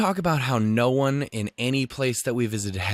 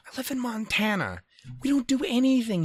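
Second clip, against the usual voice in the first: exasperated high rant; 12 semitones higher than usual. Real, not acted. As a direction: pitch shoots way up in disbelief. Mock outrage about boring Montana.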